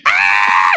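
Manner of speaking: sudden mimicked goat shriek; short screamed cry; imitated scream. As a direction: yelp it out like a screaming animal.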